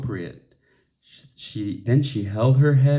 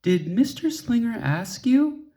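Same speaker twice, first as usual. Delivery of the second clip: mother; 12 semitones higher than usual. A narrator's reading. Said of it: "said her mother".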